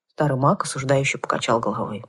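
ordinary delivery, nothing marked

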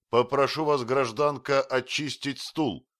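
strict, formal demand; stern order. A narrator's reading. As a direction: order them off with strict authority. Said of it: "строго проговорил Остап".

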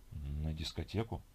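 low, soft, slow question; sluggish questioning echo. Acted. Sleepy man querying his companion's disco plan, sluggish. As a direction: echo it drowsily, rising at the end.

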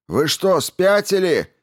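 high, loud outraged shout. A narrator's reading. Man running up to his fellow citizens, shouting. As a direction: shout it in disbelief, voice leaping high. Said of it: "это крикнул Хусари".

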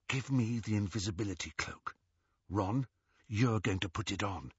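soft, hushed instructions; whispering. A narrator's reading. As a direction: direct them in a whisper. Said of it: "Hermione whispered".

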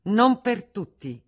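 bitter interjection at dinner table; acrid retort. A narrator's reading. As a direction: bite the words out coldly. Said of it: "intervenne acre Federico".

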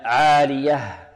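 slow عالية with an audible closing h; corrected عالية, slowly, h sounded; clear final haa. Shown as a demonstration. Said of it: "لا بد من إيضاح الهاء".

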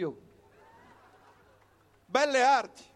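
usual speaking voice

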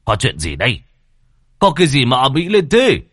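gruff master building to a loud shout; bossy old master's outburst; irritated demand. A narrator's reading. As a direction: demand what the racket is, voice rising sharply. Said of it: "vừa đi vừa hỏi".

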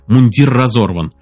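plain reading voice

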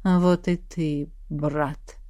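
slow, low, hushed ghostly voice; creeping nightmare whisper. Acted. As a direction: drag it out like a shadow in a fever dream. Shadow greets the feverish prince.

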